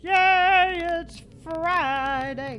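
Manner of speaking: slow, stretched sing-song cheer; sing-song yay. Acted. Streamer greeting viewers on Friday, sing-song. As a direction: drag out it's Friday like a chant. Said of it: "I don't know why I'm saying it like that".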